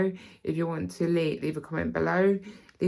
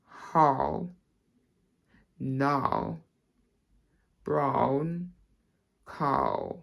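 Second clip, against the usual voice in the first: RP drill, one word at a time; spaced-out RP words with open vowels; RP accent; much slower than usual. Shown as a demonstration. Teacher repeating the phrase for learners, RP accent. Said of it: "So we'll do it again".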